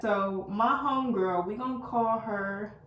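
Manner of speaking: ordinary talk, nothing marked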